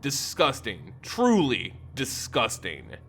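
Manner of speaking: ordinary delivery, nothing marked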